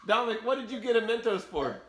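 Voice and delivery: laughing while asking. Real, not acted. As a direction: giggle through the question.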